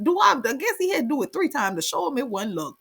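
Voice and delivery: ordinary talk, nothing marked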